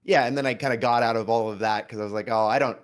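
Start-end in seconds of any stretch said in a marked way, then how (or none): none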